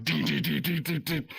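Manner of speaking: high imitated keypad tones; keypad beeps. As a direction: chirp the dial-pad beeps. Mimics callers mashing the keypad.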